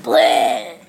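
very low "bleh" of throwing up; mock retching. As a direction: fake a vomit noise, deep and drawn out. Mister Dum Dum throws up.

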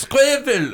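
"Squivel" pitched very high, with a V replacing the R; worsening German mispronunciation. Retold or imitated. Comic imitating Germans getting worse, high mispronounced "Squivel" with a V. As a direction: exaggerate a German failing at squirrel, high "Squivel". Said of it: "And then it gets worse".

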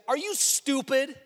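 loud, high, scolding Aaron as a fool; mock exasperated Aaron scolding the people; exasperated rebuke. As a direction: berate them like idiots, voice climbing. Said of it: "Don't you think that Aaron should have said".

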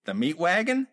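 The meat wagon? rising high in disbelief; puzzled question. Acted. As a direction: friend repeats it, confused. Ethel puzzled by the phrase.

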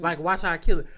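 loud, cocky boast; bragging he'll kill it, loudly; hyped boast. Retold or imitated. Hyping his own outfit.

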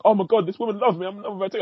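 mocking, high, rushed lovestruck gush; lovestruck man. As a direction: send up a smitten lover, high and fast. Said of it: "And he's like".

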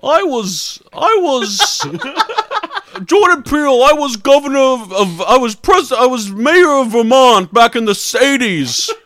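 loud, goofy impression voice; hammed-up character voice. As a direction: belt it out as a blustering caricature.

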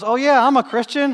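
high, loud imitation of a breezy guy; breezy quoted voice, high and loud; retold builder's boast. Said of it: "And he goes".

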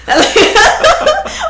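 sudden high laughter. Real, not acted. After joking about having no skin on her knees: high laugh.

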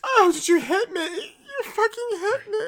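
high, wailing fake sob; shrill fake crying. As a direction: wail like she's been slapped. Slapped in mock fight.

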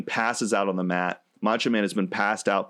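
ordinary delivery

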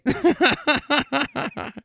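high-pitched giggle; high laugh. Real, not acted. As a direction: giggle at the joke. Laughing at his title.

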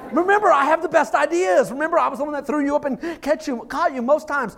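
high, loud mock-desperate dad; exasperated pleading. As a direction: plead your case to a doubting kid. Acted pleading with opinionated son.

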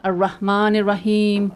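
ordinary delivery

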